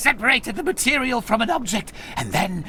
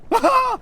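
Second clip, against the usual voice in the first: high cackle; 3 dB louder than usual. Acted. Gargamel laughs mid-scene.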